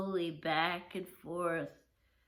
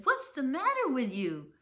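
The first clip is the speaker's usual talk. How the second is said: startled mother; 5 semitones higher, 5 dB louder than usual. Acted. Startled mom scolds her son.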